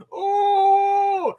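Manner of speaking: high, loud hammed-up swoon; mock swooning cry. Parodying Mikasa with the head, a high mock swoon. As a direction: gush out a fake lovestruck cry.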